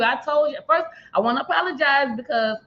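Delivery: ordinary delivery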